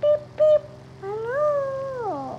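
high, loud beep-beep and a hello; playful car horn. Pretend. Greeting another car in the game with a mock horn, high and loud. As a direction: honk like a toy car, squeaky and bright.

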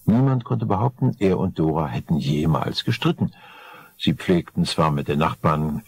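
plain reading voice

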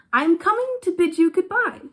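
high, loud farewell cry; calling out. A narrator's reading. Swallow calling to the prince on his return, high loud cry. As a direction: call it up to a friend. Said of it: "he cried".

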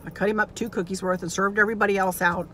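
ordinary talk

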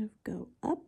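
quick whisper to self. Real, not acted. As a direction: whisper it quickly while working. Concentrating on careful detail work.

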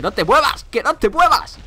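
exasperated rising squeal; rising desperate plea. Real, not acted. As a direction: beg the dino, climbing into a squeal. Pleading with dino to stay still.

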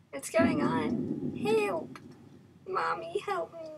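high-pitched frightened plea; panicked cry for help. Real, not acted. Player panics as game turns scary.

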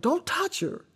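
high, quiet mock teammate plea; teammate Pam. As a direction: Pam quietly warning the others off. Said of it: "Pam's like".

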